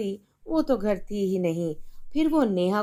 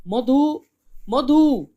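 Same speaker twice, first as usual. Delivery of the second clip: louder call across the house; 3 semitones higher, 7 dB louder, much slower than usual. Acted. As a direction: shout for your wife. Father calling wife angrily.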